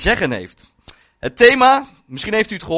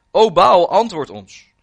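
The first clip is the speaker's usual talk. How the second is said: lightly raised call within a reading; calling on Baal; 5 semitones higher, 8 dB louder than usual. A narrator's reading. Baal's prophets plead for an answer, mild calling out. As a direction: call out to a god, louder on the name. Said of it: "Ze riepen de naam van de Baal aan".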